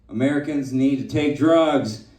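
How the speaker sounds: ordinary talk, nothing marked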